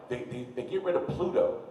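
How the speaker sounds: ordinary delivery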